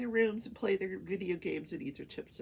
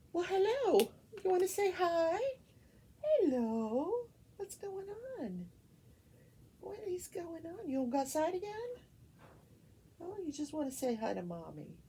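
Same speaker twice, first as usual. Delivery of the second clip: high cooing pet talk; 10 semitones higher than usual. Real, not acted. Greeting a pet that came to say hi, cooing.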